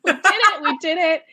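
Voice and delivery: high, loud mock victory cheer; excited triumph. Narrator reliving her win with the author, cheering high and loud. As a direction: celebrate as if you just nailed it.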